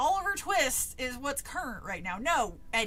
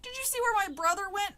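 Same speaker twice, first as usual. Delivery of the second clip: high, raised-voice question; calling out; 7 semitones higher, 4 dB louder than usual. A narrator's reading. Sister asks villagers about her brother, calling out. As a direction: call over to people at a distance. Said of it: "I called to them".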